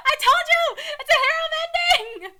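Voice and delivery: high, loud, laughing crow; triumphant laughing shout. Real, not acted. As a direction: gloat loudly through laughter. Reader crows over predicted ending.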